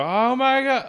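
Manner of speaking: overdone amazement; mock shock. Car crash video narrators, mock shocked gasp. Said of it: "They go".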